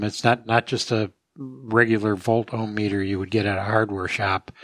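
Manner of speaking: ordinary talk, nothing marked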